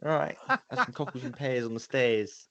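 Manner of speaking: high, bright Cockney Bert voice; cheeky Mary Poppins Cockney; Cockney accent. Pretend. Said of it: "some Bert stuff".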